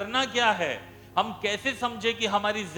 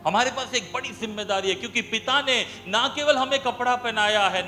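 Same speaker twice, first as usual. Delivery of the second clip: raised sermon voice, building; emphatic preaching; 3 semitones higher, 3 dB louder than usual. Acted. Preacher reminding church what the Father gave, emphatic. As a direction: drive each clause home.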